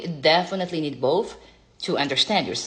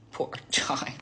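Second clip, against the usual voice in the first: whisper; 5 dB quieter than usual. Acted. Whispered aside.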